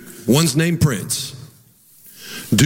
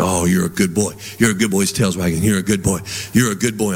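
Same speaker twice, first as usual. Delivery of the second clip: praising the dog; 8 semitones lower than usual. Retold or imitated.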